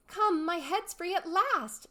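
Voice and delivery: put-on high, slow, delighted girl's cry. A narrator's reading. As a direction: relief bursting out of her. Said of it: "in a tone of delight".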